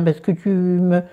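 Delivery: ordinary talk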